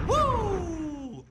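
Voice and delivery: long high "woo", held and loud; excited whoop. Real, not acted. Host opening the radio show, excited whoop. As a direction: let out a big ringing whoop.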